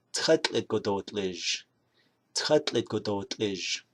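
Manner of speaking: Tchatlit godotlij said slowly with a falling end; Navajo algae green. Shown as a demonstration. Teacher showing viewers another green word, slowly. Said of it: "you can also say".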